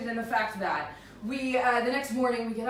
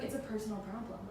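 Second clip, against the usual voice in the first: low evasive mutter; 5 semitones lower, 10 dB quieter, faster than usual. Retold or imitated. Boyfriend refusing to explain.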